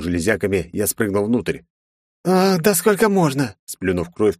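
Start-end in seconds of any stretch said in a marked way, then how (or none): 2.2-3.6 high-pitched, drawn-out exasperation; exasperated spite. A narrator's reading. Cornered Margaret at the hero, high exasperated spite. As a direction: spit out that you are sick of him. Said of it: "прошипела Маргарет".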